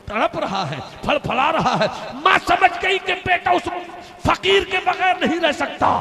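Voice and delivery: high, loud, impassioned preacher's cry; preaching at full force. Acted. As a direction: lift the crowd with a fervent shout.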